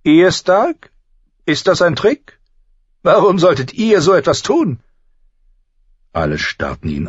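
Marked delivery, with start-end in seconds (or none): 0.0-4.8 high, loud, incredulous questioning; suspicious queen. A narrator's reading. Cersei eyeing Ned's offer, suspicious. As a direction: challenge him in a high, distrustful voice. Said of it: "Misstrauisch musterte ihn Cersei Lannister".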